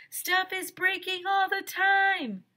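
high, slow yell downward; high slow man yelling down; yelled into the hole. A narrator's reading. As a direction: shout down to her below. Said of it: "yelled into the hole".

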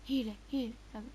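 ordinary delivery

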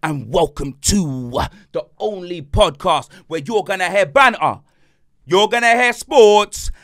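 slow, loud hype-man welcome, rising to a shout; show intro announcer. Acted. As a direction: announce the podcast like a big event.

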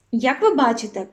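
ordinary talk, nothing marked

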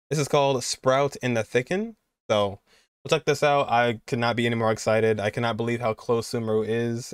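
normal talking voice

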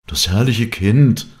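loud, drawn-out exclamation of wonder; exclaiming. Acted. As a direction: call it out, overwhelmed with joy. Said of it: "rief er".

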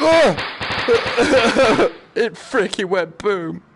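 slow, high words through laughter; laughing. Real, not acted. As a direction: giggle it out, delighted. Firework explodes, laughing.

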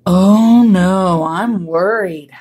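loud drawn-out lament; worried cry. A narrator's reading. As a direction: wail slowly as a worried walrus. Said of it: "cried Walrus".

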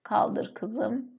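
soft, slowed repeat while writing; quiet dictation. Shown as a demonstration. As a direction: murmur it to yourself as you write it on the board. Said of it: "Şöyle".

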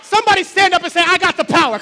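loud chanted shout; shouted call. Shown as a demonstration. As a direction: shout it out with the crowd. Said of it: "Come on, scream it".

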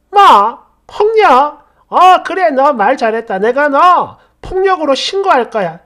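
loud, high, explosive outrage; heated shouting in a quarrel; furious retort. Acted. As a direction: fire back a threat, shouting. Shouted row, threat to report.

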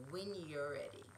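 low, soft reassurance; gentle. A narrator's reading. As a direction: ease off, patient and soft. Quinn softly gentle.

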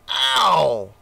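loud pained yelp; snorted ow. A narrator's reading. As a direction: elephant stung by fire water, yelping. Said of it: "he snorted".

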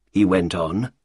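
usual speaking voice

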